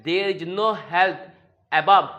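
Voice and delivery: very slow, word by word; slow dictation. Shown as a demonstration. Teacher modelling an English sentence, very slow. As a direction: spell the sentence out slowly for learners. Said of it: "तो बोला गया है".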